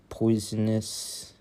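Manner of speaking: ordinary talk, nothing marked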